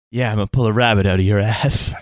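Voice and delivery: low, deadpan Seagal line; deadpan tough-guy delivery; Steven Seagal voice. Retold or imitated. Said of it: "most perfect line reads ever".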